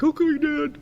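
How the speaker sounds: high, small mock-kid voice; meek little boy. Little boy answering his dad, high childlike voice. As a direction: imitate a child giving in, pitched high.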